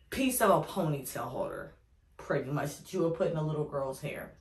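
normal talking voice

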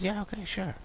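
lightly voiced wife brushing it off; wife's easy agreement. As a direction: toss off a light, airy okay like his wife. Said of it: "she said".

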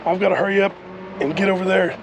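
ordinary delivery

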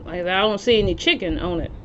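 chewing between words; talking with food in mouth; mouth full of pizza. Real, not acted. Seen 4: Cheek bulges and chewing motion while holding pizza slice, between words.

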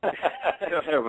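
laughing through the words. Real, not acted. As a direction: giggle while speaking. Laughing at joke.

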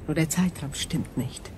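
ordinary talk

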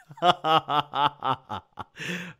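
long run of laughing; real laughter. Real, not acted. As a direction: crack up at your own joke. Laughing at own body odor joke.

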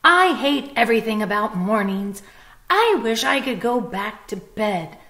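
cranky lizard who hates mornings; angry lizard. Acted. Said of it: "Lizard replied angrily".

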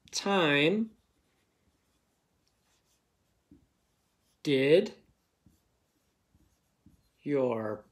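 each word alone with long gaps; word-by-word dictation. Shown as a demonstration. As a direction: read the sentence one word at a time, pausing for writing. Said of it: "Hope you clapped it and tapped it out".

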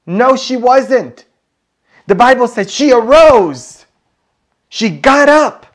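very high, loud, emphatic proclamation; preacher's ringing shout; full-force preaching. Real, not acted. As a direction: belt out the answer to the congregation.

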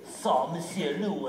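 usual speaking voice

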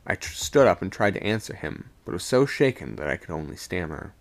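usual speaking voice

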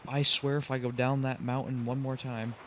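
ordinary delivery

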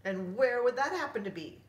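high, loud questioning pirate; pirate queen. Acted. Pirate queen asks his destination, high loud voice. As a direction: ask it with swagger. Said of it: "said the queen of the pirates".

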